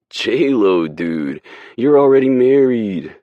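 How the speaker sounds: high, loud teasing protest; loud ribbing. Acted. Sully ribs Gerard about his wife, loud. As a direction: razz your buddy.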